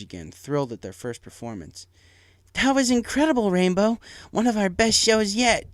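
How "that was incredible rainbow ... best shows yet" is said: high, loud, excited coach voice; high excited praise from the team captain; Spitfire congratulating. A narrator's reading. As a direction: cheer her on with a big high voice. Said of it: "Spitfire congratulated her".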